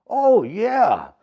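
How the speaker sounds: hobo voice. Acted.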